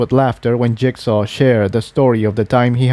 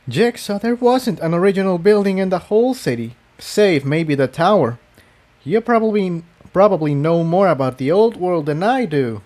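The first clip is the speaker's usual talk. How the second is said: higher, light teasing mare voice; higher smiling reply; amused Incendia; 7 semitones higher than usual. Acted. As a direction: tease him gently, smiling.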